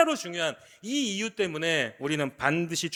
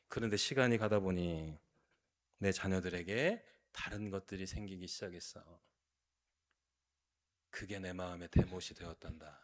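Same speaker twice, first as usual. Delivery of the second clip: much softer and lower, sorrowful; heartbroken; 9 semitones lower, 8 dB quieter than usual. Acted. God confessing his wound, soft and low. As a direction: let it sink to a hurt hush.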